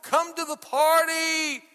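high, pleading father's plea, slowing at the end. Retold or imitated. Father pleading with his son to come to the party. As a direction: beg the son to come home. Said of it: "here's his father pleading with him".

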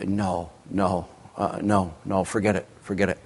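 low, soft imitation of brushing it off; mock dismissive refusal. Preacher voicing an unbeliever's reply, mock low dismissal. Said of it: "So you would say".

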